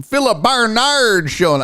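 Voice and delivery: playful Midwestern announcement; Midwestern accent. Acted. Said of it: "say it like it's a Midwestern thing".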